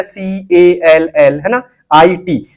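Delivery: each letter read out slowly, one by one; spelled out slowly. Shown as a demonstration. Teacher showing students how to read each letter, slow spelling. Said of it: "जैसे इसको पढ़ना है".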